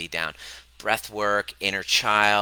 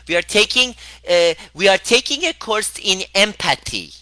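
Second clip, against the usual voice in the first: high, loud prim mom impression; sing-song imitation of a proper mom; imitating mom; 10 semitones higher, 6 dB louder than usual. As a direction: mimic mother's earnest announcing voice. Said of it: "That's how my mom talks, exactly like that".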